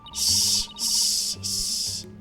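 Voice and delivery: unvoiced snake hiss. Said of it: "doing a snake voice".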